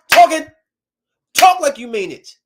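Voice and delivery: loud, high demand; exasperated outburst. Pretend. Imagined detective ordering the killer to talk properly, exasperated. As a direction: burst out impatiently at someone mumbling.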